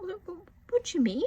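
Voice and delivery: high-pitched, stammered disbelief; retold bewilderment. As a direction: act out being thrown, tripping over the first word. Retelling her alarm at ticket desk.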